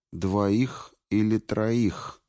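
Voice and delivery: slow, thoughtful echo of двоих или троих. A narrator's reading. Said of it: "эхом повторила Куртия".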